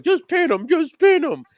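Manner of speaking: a shrill mock of a commentator begging for the pin; Michael Cole. Mocking the announcer urging a pin, in a high squeaky voice. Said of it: "Michael Cole's like".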